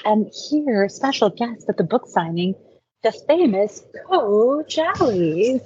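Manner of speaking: playful, drawn-out grand introduction; event announcer. Pretend. Runner announces coach as special guest at book signing, grand announcer.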